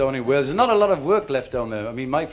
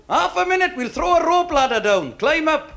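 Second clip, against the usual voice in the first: calling down a shaft; 12 semitones higher than usual. Acted. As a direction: shout down the shaft to a fallen mate. Shouting down the pit shaft.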